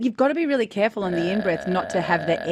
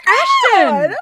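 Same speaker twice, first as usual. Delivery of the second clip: much higher, much louder cheering call; excited shout; 11 dB louder than usual. Real, not acted. Coach cheers the student's success. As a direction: yell his name in delight. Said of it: "That was it".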